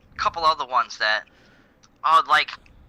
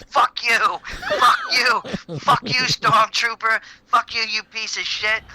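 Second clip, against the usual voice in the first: hammed-up annoyed shout; mock shout; 6 semitones higher than usual. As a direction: yell at the stormtroopers in a put-on high voice. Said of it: "He's like".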